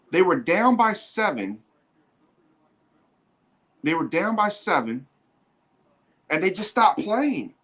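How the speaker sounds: high, amazed disbelief; stunned. Real, not acted. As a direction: sound floored by it. Stunned commentator.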